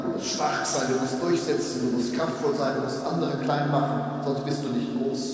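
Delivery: mock roar of the world's voices; bellowing voices. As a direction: hammer each demand like a drill sergeant. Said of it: "das Gebrüll sei stark".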